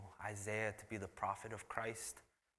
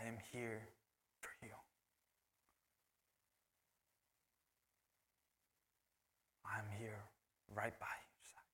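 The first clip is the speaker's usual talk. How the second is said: soft whisper, words spaced out; whispered reassurance; 7 dB quieter than usual. Acted. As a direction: breathe it close like a promise. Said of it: "And God is saying".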